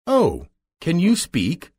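high, slow, wide-eyed question; a little girl amazed that a scarecrow talks; surprised. A narrator's reading. Dorothy hears the scarecrow speak, surprised and high. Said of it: "asked the little girl in surprise".

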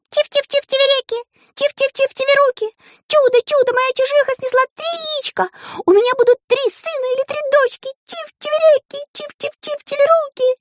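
very high, fast bird chatter; chirpy siskin. A narrator's reading. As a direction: chirp it like a happy little bird. Siskin sings joyfully.

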